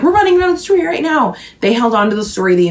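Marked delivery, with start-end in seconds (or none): 0.0-1.3 high, panicked report. Retold or imitated. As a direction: pretend to be running scared. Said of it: "And they were like".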